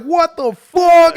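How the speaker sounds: high, loud, drawn-out outcry; slow, booming, baffled yell; mock disbelief. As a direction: stretch it out in hammed-up shock. Acted shocked viewers, repeated.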